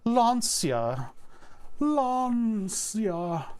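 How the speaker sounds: Lancia with a drawn-out "ah"; mock-refined pronunciation. Shown as a demonstration. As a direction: stretch Lancia into "Laaahn-sia", hammed-up. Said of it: "You gotta be in polite society".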